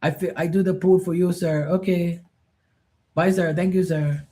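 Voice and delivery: a hint of the deferential pool cleaner; imitating Filipino pool guy. Pool cleaner greeting and leaving, in a lightly Filipino-accented polite voice. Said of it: "everything is".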